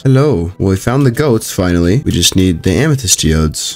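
ordinary talk

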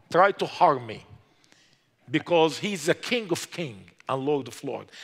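normal talking voice

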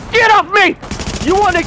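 high angry yell. Real, not acted. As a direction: shout at the attacker. Enemy rushes him.

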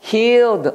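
teacher answering her own cue, stressing Healed; emphatic answer. Shown as a demonstration. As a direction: prompt the class with a punchy Healed. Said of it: "운 다음에 어디서?".

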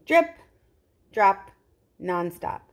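bouncy sing-song story voice, each word punched; sing-song reading to children; rhyming read-aloud. Acted. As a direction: read it like a picture book chant to kids. Repeated refrain of picture book.

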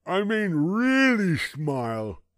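put-on high, slow gummy old man stressing really; insistent toothless grandpa. A narrator's reading. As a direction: press the officer like a grumpy grandpa.

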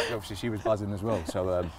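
normal talking voice

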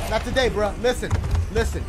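normal talking voice

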